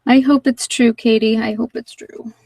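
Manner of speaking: ordinary talk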